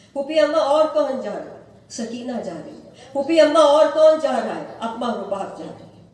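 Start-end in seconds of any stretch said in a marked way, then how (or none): 0.1-1.6 higher, louder, fast pleading; a little girl pressing her aunt for an answer; eager child's question. Acted. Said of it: "Sughra کا اشتیاق بڑھتا جا رہا ہے". 3.1-4.7 much louder and higher, near a wail; the girl begging, fearing she is left behind; desperate child's cry. Acted. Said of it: "Sughra کا اشتیاق بڑھتا جا رہا ہے".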